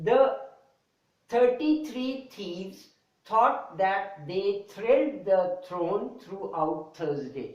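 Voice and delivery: very slow, every th sound spelled out; slow deliberate tongue twister read; slow tongue twister demo. Shown as a demonstration. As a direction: carefully drag out the twister. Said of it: "This is a good tongue twister".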